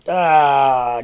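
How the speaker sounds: loud satisfied ahh after a cold sip; refreshed ahh after a drink. Real, not acted. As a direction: let out a big refreshed ahh. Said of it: "nothing like a good cold drink".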